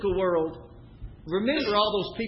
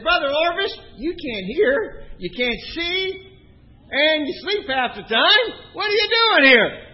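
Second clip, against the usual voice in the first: raised, higher-pitched questioning, building to the last question; loud teasing challenge; 8 semitones higher, 6 dB louder, slower than usual. Retold or imitated. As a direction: call it out to an old man who can't hear. Loudly questioning a hard-of-hearing old man.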